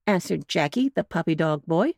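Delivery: plain reading voice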